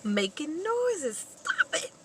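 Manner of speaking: put-on high, loud, panicked boy pleading; scared son. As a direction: hush Daddy in fright. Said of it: "the son is like".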